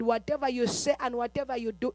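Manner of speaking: ordinary talk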